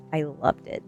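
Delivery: ordinary delivery, nothing marked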